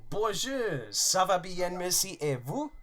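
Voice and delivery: northern English voice doing French; French with northern accent. Pretend. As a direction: show off your only schoolboy French. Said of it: "That's about all I can remember of French".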